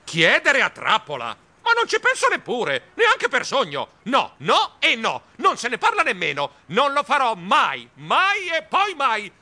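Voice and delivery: high, drawn-out, emphatic refusals; outraged shrieking. A narrator's reading. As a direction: refuse at the top of your pitch. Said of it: "Io strillai". (strilli acuti e indignati)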